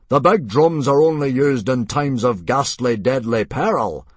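disbelieving bluster; amazed outrage. A narrator's reading. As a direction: protest in loud amazement. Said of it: "said Mogadon in amazement".

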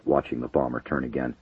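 plain reading voice

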